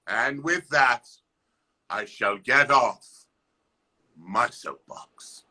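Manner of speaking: stately mock-pompous farewell; grand sign-off. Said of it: "grand sign-off".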